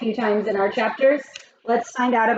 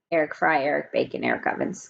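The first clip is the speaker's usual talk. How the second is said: names repeated softer, fading into a whisper; 8 semitones lower, 5 dB quieter than usual. A narrator's reading. Teacher echoing the three names to kids, fading to a whisper. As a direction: let the names die away to a whisper.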